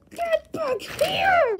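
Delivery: high, drawn-out play shout; calling after. Pretend. Toy pursuer shouting after a runaway, commanding. Pretend chase.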